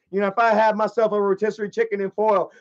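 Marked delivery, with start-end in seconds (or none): none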